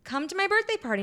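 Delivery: very high mock friend voice; inviting friend. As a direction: pipe up high, imitating an eager invitation.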